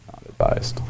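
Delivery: quiet, low aside repeating the verdict; hushed echo. Real, not acted. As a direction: drop low and nearly whisper it.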